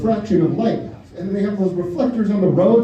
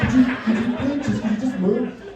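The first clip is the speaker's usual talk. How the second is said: exasperated plea; 4 semitones higher than usual. Retold or imitated. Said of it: "You're like".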